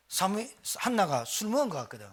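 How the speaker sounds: usual speaking voice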